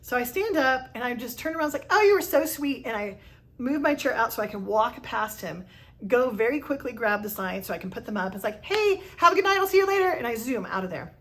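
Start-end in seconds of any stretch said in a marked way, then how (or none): 1.9-2.9 higher and louder, chirpy and forced; bright fake politeness to escape; awkward deflection. Brushing off coworker's compliments, forced chirpy. 8.6-10.1 much higher and louder sing-song farewell; bright fake cheer while fleeing; forced cheerful goodbye. Rushing past coworker to leave, overly cheery goodbye.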